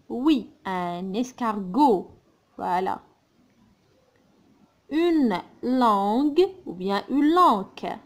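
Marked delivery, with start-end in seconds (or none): 4.9-8.0 "langue" first ending in G, then wrongly ending in K like "lanque"; G then K contrast. Shown as a demonstration. Teacher to pupils choosing between two ways of saying "langue". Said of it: "On dit la langue".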